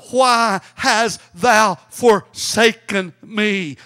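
loud, very slow cry to heaven; preacher acting Jesus' anguished call; forsaken cry. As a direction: cry out each word, long and heavy. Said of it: "I'm willing to cry".

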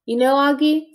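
usual speaking voice

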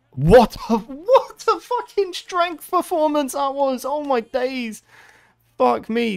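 high, drawn-out slow amazement; stunned disbelief. Real, not acted. Gamer marvelling at opponent's strength, high and slow. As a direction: let the disbelief stretch out.

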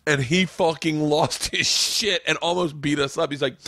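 ordinary talk

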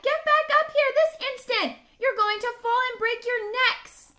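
high, loud frantic mother bird; shrieked scolding order, very high-pitched; cried in alarm. A narrator's reading. Mama bird orders dangling babies back up, loud shriek. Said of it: "she cried".

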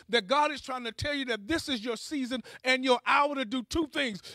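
ordinary talk

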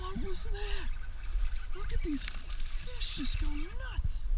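excited disbelief. Real, not acted. Angler watching fish go nuts, high amazed voice. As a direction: marvel at the frenzy.

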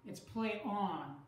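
"play" hit firmly, "on" lighter and falling; slow, weight on "play", let "on" drop; stress on play. Shown as a demonstration. Teacher contrasting stress for students, slow and weighted. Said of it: "it's not as accented as on".

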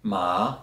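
"ma" gliding upward from low; rising tone. Shown as a demonstration. Teacher showing learners the sesame word, rising "ma". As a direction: start "ma" low and lift the pitch. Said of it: "If I use a rising tone".

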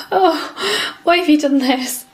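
high-pitched mock-baffled pet voice; puzzled dog's voice. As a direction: voice a dog's bewildered complaint. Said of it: "he just sat looking at them all like".

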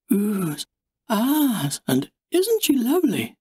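cooing visitors. A narrator's reading. Visitors cooing over a puppy.

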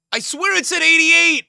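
frustrated yell. Real, not acted. Squad build suddenly fails.

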